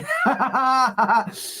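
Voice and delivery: high burst of laughter; laughing. Real, not acted. Host after teasing Rob about a clout, high laughter. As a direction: cackle at your own joke.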